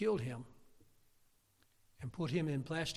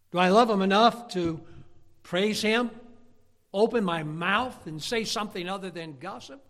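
louder, higher, pressing challenge; fiery preaching; 4 semitones higher, 9 dB louder than usual. Real, not acted. Preacher challenging the congregation, louder and higher. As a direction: drive each question home to the pews.

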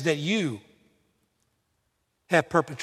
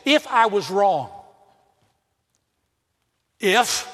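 grudging conditional apology, half-hearted; the wrong way to apologize, with a hedging if; slower than usual. Shown as a demonstration. Pastor mocks an insincere apology, hedging and half-hearted. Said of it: "never say that".

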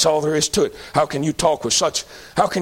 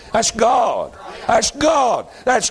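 building emphasis; 5 semitones higher, much slower than usual. Shown as a demonstration. Preacher answering who the baby is, building to a ringing shout. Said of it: "Who is that?".

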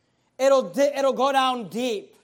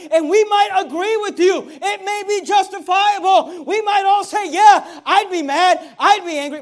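loud preaching building to a high shout; forceful preaching; 4 semitones higher, 8 dB louder than usual. Real, not acted. Preacher conceding to the congregation their grievance, full-force preaching. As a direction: drive each phrase harder until it is shouted.

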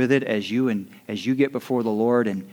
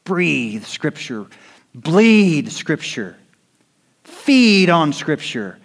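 slow building exhortation; 4 semitones higher, 5 dB louder, slower than usual. Acted.

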